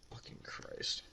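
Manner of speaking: breathy sigh into a whispered curse; exasperated whispered sigh. Real, not acted. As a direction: let it all out in a whispered groan.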